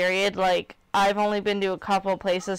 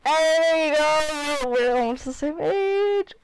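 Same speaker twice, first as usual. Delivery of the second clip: slow silly voice; 7 semitones higher, 3 dB louder, much slower than usual. Acted. Slow high put-on voice.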